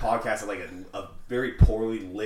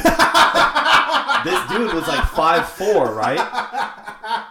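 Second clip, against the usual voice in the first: high, loud, talking through laughter; laughing; 8 semitones higher, 11 dB louder, much slower than usual. Real, not acted. Man telling friend about a small guy, laughing. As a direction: crack up while telling it.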